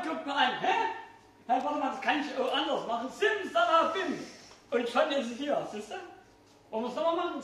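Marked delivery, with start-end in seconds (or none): 3.1-4.3 slow, drawn-out magic word; kids party magician. Acted. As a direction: stretch the spell out for effect.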